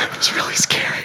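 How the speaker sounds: whisper. Real, not acted. Telling of losing her file.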